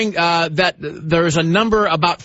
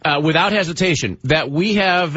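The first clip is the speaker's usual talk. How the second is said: ordinary delivery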